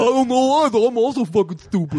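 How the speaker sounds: dopey rhino henchman voice; Rocksteady voice. Retold or imitated. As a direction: play the dim-witted rhino goon. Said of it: "I'm way more into Bebop and Rocksteady".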